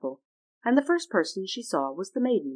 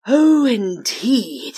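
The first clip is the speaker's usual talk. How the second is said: slow, loud booming wind spirit; drawn-out windy exclamation; South Wind voice; 3 semitones higher, 10 dB louder, much slower than usual. Acted.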